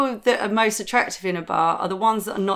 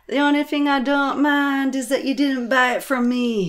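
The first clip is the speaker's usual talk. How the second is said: neighbour impression; 5 semitones higher than usual. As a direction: call it out as the accented older neighbour. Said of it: "she was all like".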